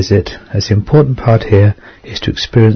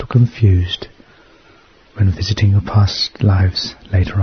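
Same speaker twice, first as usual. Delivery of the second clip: guided meditation. Acted. Leads a hypnosis meditation.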